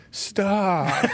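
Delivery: scared plea. Retold or imitated. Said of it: "Alan goes".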